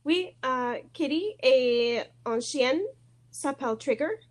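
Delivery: ordinary talk, nothing marked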